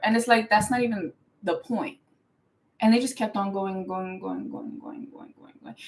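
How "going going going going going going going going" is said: going trails off into a whisper; fading to whisper. Acted. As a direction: let each going fade until only breath. Mocking the endless rant.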